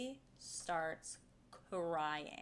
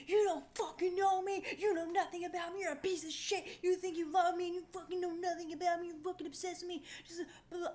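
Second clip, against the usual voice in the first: retold rant; 10 semitones higher, faster than usual. Teller replays yelling at the driver, higher angry rant. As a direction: tear into him, ranting. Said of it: "I was like".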